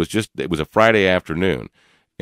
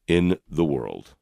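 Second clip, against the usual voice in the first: slow emphatic repeat; 3 semitones lower, much slower than usual. Real, not acted.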